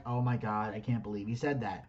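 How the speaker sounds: group murmur of shock; hushed shock. Retold or imitated. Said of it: "everyone was like".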